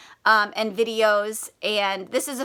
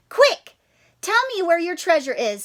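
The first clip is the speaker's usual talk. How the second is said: urgent plea; 5 semitones higher than usual. Acted. Character urges leprechaun.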